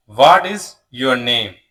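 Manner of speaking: ordinary delivery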